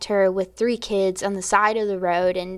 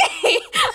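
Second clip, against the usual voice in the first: high laugh. Real, not acted. Laughing retelling pastor's request.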